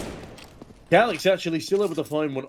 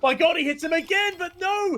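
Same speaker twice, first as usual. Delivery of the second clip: excited; 14 semitones higher than usual. Real, not acted. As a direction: voice jumps high with excitement. Caster reacts to a near kill.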